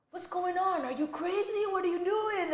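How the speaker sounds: high startled voice of a passing driver; stranger's alarmed questions. Retold or imitated. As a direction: play the man knocking on the window, high and startled. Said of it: "and he goes".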